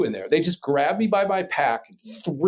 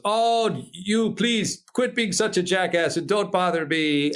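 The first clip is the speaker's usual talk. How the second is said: put-on higher, drawn-out French-accented English; imitated French accent; 6 semitones higher, slower than usual. Frenchman fed up with the American caller, imitated French accent. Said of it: "his English wasn't great".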